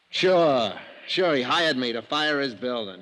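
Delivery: loud, sneering burst of anger; bitter outburst. Acted. Arsonist bursting out bitterly to the investigators, loud. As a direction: spit it out bitterly.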